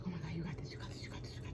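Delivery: rapid whispered mantra to herself; self pep talk. Retold or imitated. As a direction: imitate whispering encouragement under your breath. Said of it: "I gotta be like".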